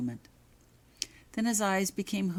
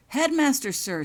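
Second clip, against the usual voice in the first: respectful; 11 semitones higher, 8 dB louder than usual. A narrator's reading. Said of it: "stated Richards respectfully".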